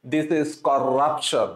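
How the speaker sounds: teacher mocks an Arabic or Russian accent on corruption; mock foreign accent. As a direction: parody the Arabic or Russian accent, dragging each word. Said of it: "Arabic বা রাশিয়ানদের মতো পড়তে বলছিলাম না".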